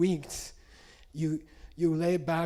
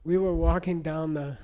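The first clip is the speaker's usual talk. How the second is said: ordinary talk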